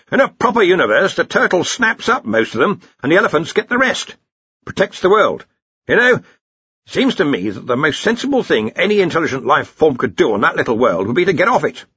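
high, emphatic lecturing wizard; opinionated senior wizard. Acted. Narrator voices Ridcully's lecture.

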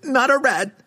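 very high panicked squeal; terrified shriek. A narrator's reading. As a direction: shriek it in terror. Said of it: "the terrified thief shrieked".

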